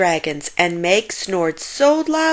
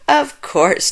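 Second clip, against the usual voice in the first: chuckling; 7 semitones higher, 3 dB louder than usual. A narrator's reading. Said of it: "chuckled George".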